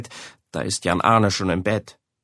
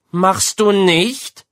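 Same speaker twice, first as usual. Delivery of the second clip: slow, higher, louder disbelief; doubting mother; 9 semitones higher, 6 dB louder, much slower than usual. A narrator's reading. Mother doubting her son's denial, sceptical. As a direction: question his denial pointedly. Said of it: "sagt Mama".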